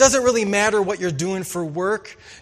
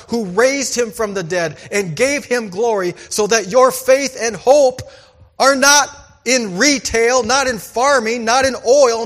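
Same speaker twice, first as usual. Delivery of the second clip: louder, higher preaching voice building through the nots; full-force preaching; 4 semitones higher, 5 dB louder than usual. Acted. Pastor preaching hope in God to the church, louder and higher. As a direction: preach it out to the congregation.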